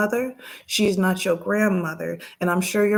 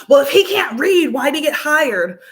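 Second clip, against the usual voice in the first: higher and louder snooty jab; hammed-up entitled customer; mocking the rude customer; 6 semitones higher, 7 dB louder than usual. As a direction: sneer it as the rude lady would. Voicing the rude lady's argument.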